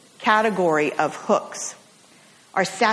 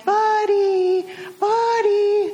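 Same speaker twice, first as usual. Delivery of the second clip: higher, sing-song call down a long lane; owner calling her dog; 10 semitones higher, much slower than usual. Retold or imitated. Farm owner calls her runaway puppy down the lane, high sing-song call. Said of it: "the owner walking down the long lane".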